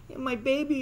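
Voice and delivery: high put-on whimper; mock crying girl. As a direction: imitate her fake tears. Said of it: "she starts crying".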